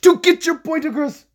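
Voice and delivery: sudden high, loud burst; Mister Burns. Shown as a demonstration. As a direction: jump up high to drive it home. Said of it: "fast speaking and talking at certain points".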